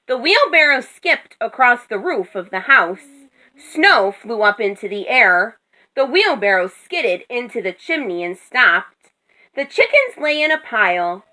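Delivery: plain reading voice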